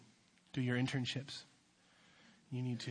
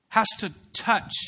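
loud, high preaching voice; full-force preaching; 8 semitones higher, 12 dB louder than usual. Acted. Youth pastor preaching about salt to the congregation, loud and high. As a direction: drive the point home with power.

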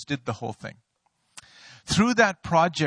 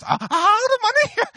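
very high falsetto squeak of panic; voice up three octaves; 6 dB louder than usual. Retold or imitated. Pastor mocking his own panic on the phone, falsetto squeal. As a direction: shoot way up into a disbelieving squeal. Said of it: "my voice went up three octaves".

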